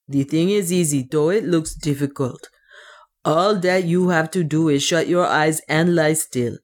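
put-on accented dwarf character voice, reassuring; dwarf servant, confident. The dwarf explains the crossing, confident accented voice. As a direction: instruct them as the brave accented dwarf.

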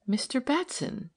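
high, hushed exclamation of recognition; soft cry. A narrator's reading. Woman wheeling round to recognise an old acquaintance, soft cry. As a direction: cry out softly, pitch lifted. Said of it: "she cried softly".

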